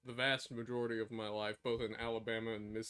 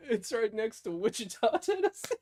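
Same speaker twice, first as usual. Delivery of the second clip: high, loud, giggly follow-up; joking through laughter; 8 semitones higher, 5 dB louder than usual. Pretend. Piling on the silly geography joke, giggling. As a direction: barely hold the laugh in.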